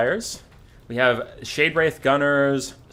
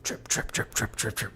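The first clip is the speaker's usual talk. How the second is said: dripping sound; 4 semitones lower, 9 dB quieter than usual. Retold or imitated. Imitated dripping water.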